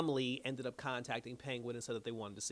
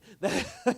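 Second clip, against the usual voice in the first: loud real laugh; 10 semitones higher, 7 dB louder than usual. Real, not acted. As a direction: burst of high, loud laughter. Host laughs at the shooting.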